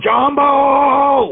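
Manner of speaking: high, drawn-out call of jumbo; imitated gleeful gambler's holler; mock jackpot cry. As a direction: stretch it out high, like cheering on a slot wheel. Imitates friend yelling at slot wheel.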